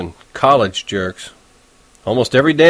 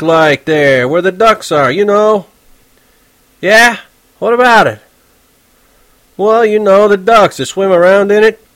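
lightly voiced teenager, a bit higher; eager, polite kid asking questions; young Holden; 9 semitones higher, 5 dB louder than usual. Narrator voicing Holden asking the cabbie about ducks.

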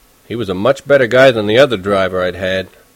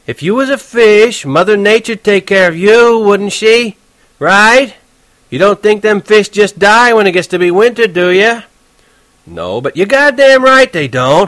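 slow, higher, louder New York cabbie; touchy cabbie; 10 semitones higher, 5 dB louder than usual. Acted. Horwitz explaining fish to Holden, irritated. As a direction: lecture the kid, exasperated.

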